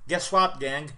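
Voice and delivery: ordinary talk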